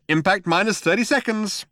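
high-pitched computer announcement; chirpy computer. A narrator's reading. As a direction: chirp out the countdown. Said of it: "chirped the computer".

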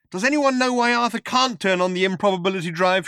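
high, urgent shout. A narrator's reading. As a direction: shout the question over the singing. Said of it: "shouted Trillian".

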